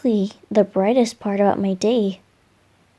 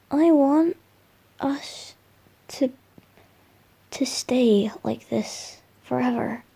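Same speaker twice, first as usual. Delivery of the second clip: intimate whisper; 5 dB quieter than usual. Acted. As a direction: breathe the words close to the ear. Intimate confession to the listener.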